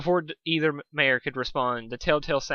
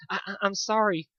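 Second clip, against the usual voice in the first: stutter; 7 semitones higher than usual. Acted. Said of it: "stuttered a confused Fluttershy".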